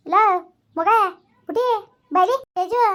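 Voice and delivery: calls the name loud and high across the house; calling out. Acted. Mother calls her daughter from another room, loud call.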